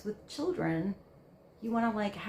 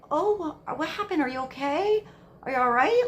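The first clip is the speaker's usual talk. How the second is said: higher, caring concerned questions; nurturing mother; 7 semitones higher, 8 dB louder than usual. Retold or imitated. Mother checking on a fallen child, concerned and higher. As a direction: coo over a hurt child with concern. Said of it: "you're nurturing".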